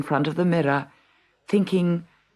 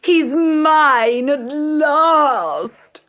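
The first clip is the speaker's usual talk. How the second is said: high, loud, very slow gloating; crying out; 12 semitones higher, 5 dB louder, much slower than usual. A narrator's reading. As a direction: savour the triumph like a vain sister. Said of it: "cried Bertha".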